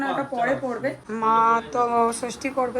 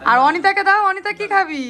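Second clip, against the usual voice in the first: high, loud call across the room; 8 semitones higher, 7 dB louder than usual. Real, not acted. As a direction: call out to Anita over the crowd. Calling Anita across crowded room. (ভিড়ের মধ্যে অনিতাকে ডাকা, উঁচু জোরালো গলায়)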